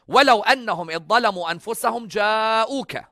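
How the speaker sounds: high, loud, resonant Arabic recitation; Quran recitation. Acted.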